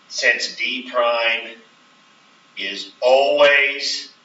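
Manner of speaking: slow, each word drawn out, with "always" leaned on; emphasis on always. Shown as a demonstration. Teacher slowing down to stress "always" for the class. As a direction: stretch "always" out slowly to make it stick. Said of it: "the emphasis on the word always".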